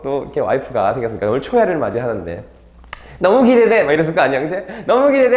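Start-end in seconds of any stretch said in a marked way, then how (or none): 3.2-3.8 high, loud, gushing excitement; giddy anticipation. Acted. Groom awaiting his bride on the wedding night, giddy anticipation. 4.8-5.4 high, loud, gushing excitement; giddy anticipation. Acted. Groom awaiting his bride on the wedding night, giddy anticipation.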